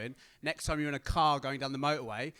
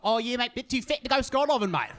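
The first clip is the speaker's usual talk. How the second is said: high, louder cheery manager voice; mock Aussie manager; 7 semitones higher, 5 dB louder than usual. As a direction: breezily tell him he's too fat. Manager calls him too fat.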